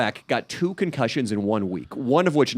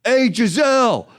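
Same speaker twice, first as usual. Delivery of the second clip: high, loud, drawn-out holler; taunting New York loudmouth shout; drunk New York heckler; 10 semitones higher, 5 dB louder than usual. Retold or imitated. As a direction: yell across a hall like an obnoxious drunk fan. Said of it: "some fucking drunk New York asshole".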